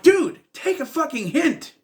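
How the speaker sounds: fed-up, high-pitched outburst; exasperated telling-off. Acted. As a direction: scold a clueless kid at the end of your patience. Exasperated imagined address to kid.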